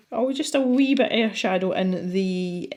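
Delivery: slow, broad Scottish lilt on wee; Scottish accent. Real, not acted. Artist hearing her own Scottish accent while shading, slow Scottish lilt. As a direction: lean into the Scottish in "a wee bit of shadow". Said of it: "that was very Scottish".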